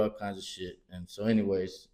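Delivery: usual speaking voice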